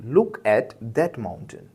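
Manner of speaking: very slow, "look" stressed; slow pointing look. Shown as a demonstration. Teacher shows how to direct someone to the mountain, very slow. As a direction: draw out "Look at that mountain" slowly. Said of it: "अब मैंने कहा".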